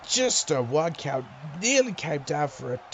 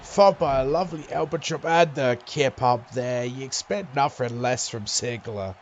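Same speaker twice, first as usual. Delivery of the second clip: lively play-by-play call; wrestling commentator. Acted. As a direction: call the match as it happens.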